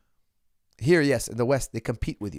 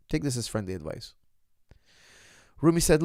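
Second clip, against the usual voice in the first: ordinary delivery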